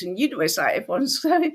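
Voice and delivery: ordinary talk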